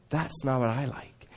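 God quietly rejecting the proud prayer, hushed; God's quiet verdict. Acted. God judges the Pharisee's prayer.

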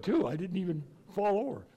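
normal talking voice

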